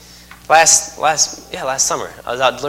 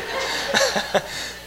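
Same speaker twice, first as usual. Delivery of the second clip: high burst of laughter; 8 dB quieter than usual. Real, not acted. Laughing at Batman runner.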